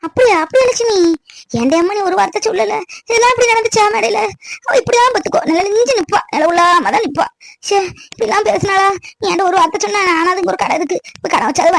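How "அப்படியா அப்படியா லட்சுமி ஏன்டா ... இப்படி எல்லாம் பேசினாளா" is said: high-pitched, incredulous questioning; shocked indignation. Acted. Relative hears Lakshmi was slighted at the function, shocked and indignant. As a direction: react aghast at the news.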